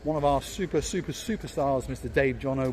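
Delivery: ordinary talk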